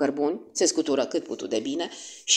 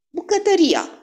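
high, louder exclamation; sudden realisation; 13 semitones higher, 5 dB louder than usual. A narrator's reading. Poirot realises where the hiding place is, exclaiming. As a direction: burst out on a much higher pitch. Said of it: "Am fost un imbecil".

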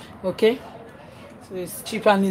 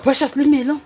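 raised, higher call to leave her alone; busy mum telling her son to leave her alone; scolding call; 5 semitones higher, 5 dB louder, faster than usual. Real, not acted. Mother scolding child while busy.

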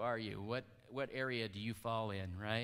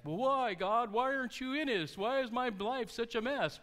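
mock wailing complaint; 9 semitones higher than usual. Christians complaining to God, high-pitched mock wail. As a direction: wail it like a hammed-up lament. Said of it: "they're just like".

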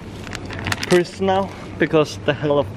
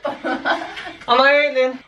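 drawn-out, very slow alien line broken by laughter; slow laughing alien; 6 semitones higher, much slower than usual. Pretend. Cracking himself up on camera, slowed mock alien voice. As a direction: stretch it out slowly, cracking up. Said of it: "I made a voice that I came from the universe".

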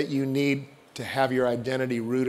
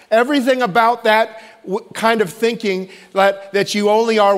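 loud, raised preaching voice; preacher building to a loud climax; fervent preaching; 6 semitones higher, 4 dB louder than usual. Acted. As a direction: drive the point home from the pulpit. Preaching at full force.

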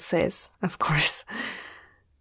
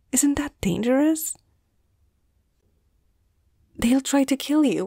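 higher-pitched, worried questioning; alarmed disbelief; 5 semitones higher than usual. Acted. Doctor hearing patient will return to dangerous pack, alarmed. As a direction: question them in mild alarm, pitch lifted.

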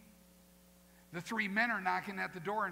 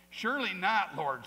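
emphatic refusal; 2 semitones higher, 10 dB louder than usual. Acted. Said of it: "said Peter".

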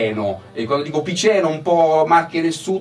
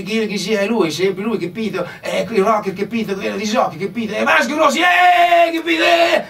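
high, excited rocker impression; hammed-up rock idol shout; rock star impression; 8 semitones higher than usual. Imitating [name] with hyped catchphrase.

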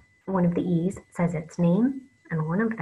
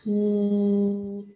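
sounding out slowly; 2 semitones higher than usual. Shown as a demonstration. Teacher sounding out a word for children, slow and drawn out. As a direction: stretch the sound out, slow and clear. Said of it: "you can say it slowly".

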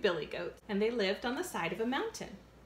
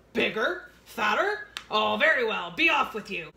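louder troll voice; 6 dB louder than usual. Acted. Troll letting the little goat go, louder. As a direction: bark it out grudgingly as the troll.

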